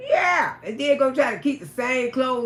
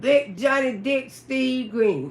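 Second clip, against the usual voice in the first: slowly calling him out; slower than usual. Real, not acted. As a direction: drag the name out slowly, teasing. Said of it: "I'ma call your name".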